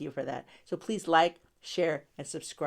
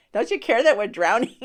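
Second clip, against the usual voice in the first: mock plea; 5 semitones higher, 10 dB louder than usual. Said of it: "listen to that question".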